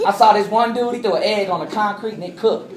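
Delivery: fast, hammed-up Mississippi accent; Southern accent. Speaker jokes about Phoenix heat, Southern accent. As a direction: play a lively Southern country talker. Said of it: "I talked like this".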